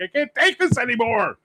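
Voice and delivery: a player who has had enough; exasperated outburst. Retold or imitated. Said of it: "he just lost it".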